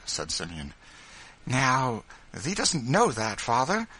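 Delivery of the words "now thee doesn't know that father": higher, louder delighted question; happy, raised wifely voice; joyful surprise. A narrator's reading. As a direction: ask it radiant with joy. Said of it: "radiant with joy".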